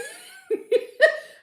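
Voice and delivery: a real laugh that hides a sigh; loud high burst of laughter. Real, not acted. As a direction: let out a big high laugh to keep from crying. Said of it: "I'm laughing to keep from crying".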